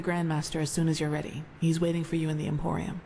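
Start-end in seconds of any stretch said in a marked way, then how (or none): none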